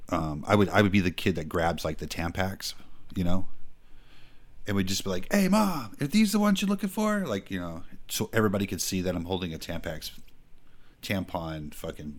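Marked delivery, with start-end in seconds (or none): 5.3-7.3 high mock kid voice. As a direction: call out to mom, faux-innocent. Kid pranking mom in store.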